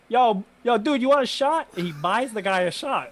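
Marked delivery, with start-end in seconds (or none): 0.1-1.7 higher, louder mock-cheery call; imitating a friendly offer. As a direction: playfully imitate the friend buying a stranger a shot. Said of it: "was like".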